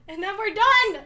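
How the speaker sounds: bright loud announcement of being done; excited. Real, not acted. To the stream, loudly declaring the outfit done. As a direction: proudly declare it finished.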